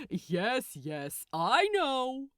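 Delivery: loud, brushing-off impatience; impatient. A narrator's reading. Gabriel waving off Crowley's disbelief, loud and impatient. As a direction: cut him off, annoyed. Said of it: "said Gabriel impatiently".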